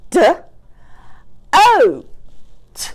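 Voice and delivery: "d, o, t" in isolated sounds, O high; chopped-out word. Shown as a demonstration. Teacher changing the vowel for pupils, chopped apart. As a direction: sound out DOTE with long gaps. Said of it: "What about".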